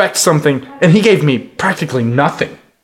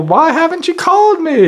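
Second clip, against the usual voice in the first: mock eager guy; 13 semitones higher than usual. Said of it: "he runs up to my table".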